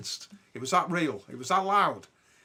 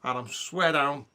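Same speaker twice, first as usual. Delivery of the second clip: ordinary delivery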